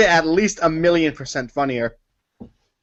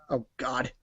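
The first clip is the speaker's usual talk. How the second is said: ordinary talk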